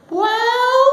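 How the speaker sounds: put-on very high, loud squeal of a kid on TV; embarrassing younger self. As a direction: squeak it out, drawn out and awkward. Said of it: "one of the more embarrassing moments".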